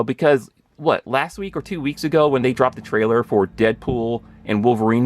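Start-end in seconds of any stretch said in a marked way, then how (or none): none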